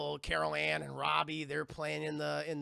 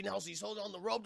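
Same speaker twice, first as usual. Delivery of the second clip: usual speaking voice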